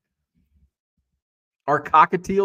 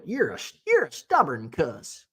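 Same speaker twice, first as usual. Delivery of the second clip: coworker's Southern-style insult, imitated. Recalling a coworker's pet phrase, imitated Southern twang. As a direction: lightly put on a Southern twang. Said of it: "I had a coworker who".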